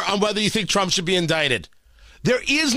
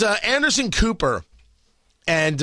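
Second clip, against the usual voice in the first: usual speaking voice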